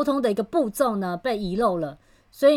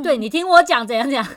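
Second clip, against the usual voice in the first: high, louder insistent cut-in; mother cutting in on her son mid-quarrel, louder; mock argument; 4 semitones higher, 6 dB louder than usual. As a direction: talk over the son, insisting.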